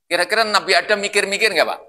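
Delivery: ordinary talk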